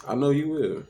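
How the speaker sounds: high, loud and sharp; the unfiltered version, nearly shouted; what he really thought. Retold or imitated. Thinking it hard at the girlfriend, loud and high. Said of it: "That's why I ain't say that part out loud".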